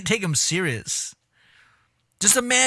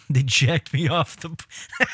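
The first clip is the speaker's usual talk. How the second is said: cracking up; slower than usual. Real, not acted.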